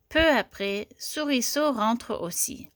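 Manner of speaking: usual speaking voice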